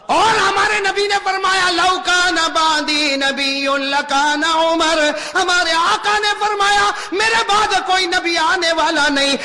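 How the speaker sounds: high, loud, slow solemn proclamation; preaching at full force. Real, not acted. Preacher solemnly quoting the Prophet to the congregation, loud. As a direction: proclaim it to the crowd with weight.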